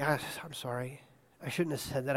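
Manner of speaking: quiet, contrite sorry; hushed and sheepish; soft apology. Retold or imitated. Husband quietly apologises to his wife.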